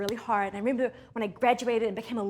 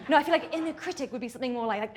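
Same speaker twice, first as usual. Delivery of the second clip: ordinary talk, nothing marked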